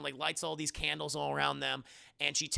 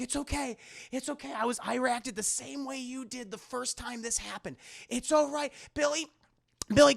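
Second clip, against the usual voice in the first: high-pitched, sing-out grandmother voice; acted doting grandma fussing; reassuring grandma; 9 semitones higher, 5 dB louder than usual. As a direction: soothe a child spirit before stepping away. Grandmother reassuring friend and spirit.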